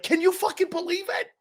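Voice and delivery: high, breathless disbelief of a starstruck fan; gushing fan. Retold or imitated. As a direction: squeal it like you can't believe your luck. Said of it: "Sitting there going like".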